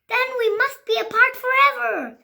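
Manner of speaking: high loud anguished line; dramatic lament. Acted. Gerald telling Piggy they must part, high lament. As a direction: wail it like a tragedy.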